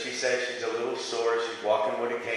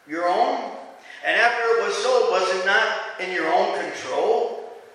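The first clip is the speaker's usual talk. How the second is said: raised, measured Bible reading; scripture reading; 6 semitones higher than usual. Acted. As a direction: read it out like from the pulpit. Reads scripture aloud.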